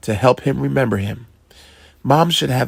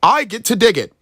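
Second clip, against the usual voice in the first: put-on high, eager kid voice; a boy thrilled to start digging; excited; 10 semitones higher, 3 dB louder, faster than usual. A narrator's reading. Boy to his dad, excited high child voice. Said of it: "Sandy said excitedly".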